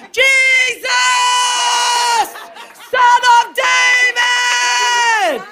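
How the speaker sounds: very high, drawn-out scream. Acted. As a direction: scream the name, stretching every word. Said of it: "Sometimes you gotta get a little louder".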